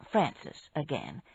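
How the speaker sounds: plain reading voice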